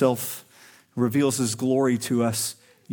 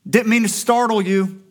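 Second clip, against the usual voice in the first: breezy, offhand, and pitched higher and louder; casual mock Jesus; 8 semitones higher, 5 dB louder than usual. Jesus casually greeting startled disciples, mock breezy. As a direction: toss it off like a friend who just popped by. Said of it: "He's not just saying".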